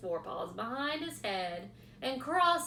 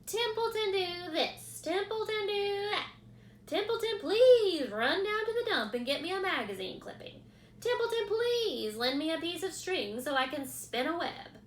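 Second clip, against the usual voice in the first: higher, louder sneering rat; disgusted mockery; 9 semitones higher, 6 dB louder than usual. A narrator's reading. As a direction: rat mocking everyone's demands. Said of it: "he said in disgust".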